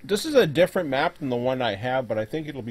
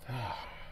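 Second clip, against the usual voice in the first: disappointed sigh; 13 dB quieter than usual. Real, not acted. Disappointed by the zoom limit.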